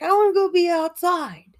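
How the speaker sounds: put-on high, loud kid whine; whiny kid. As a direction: beg like a bored child. Quotes childhood self, loud high.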